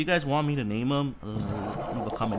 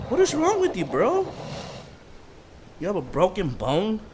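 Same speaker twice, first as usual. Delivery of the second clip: high, exasperated scolding at a pet; what is wrong with you, bro; 8 semitones higher, 3 dB louder than usual. Real, not acted. Scolding his raptor in game.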